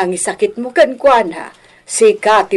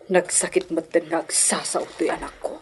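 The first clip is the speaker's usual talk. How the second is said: very soft, low and wounded; quiet, pained murmur; hurt lament; 5 semitones lower, 13 dB quieter than usual. Acted. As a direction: say it faintly, deeply hurt. Hurt parent reacts to child's words.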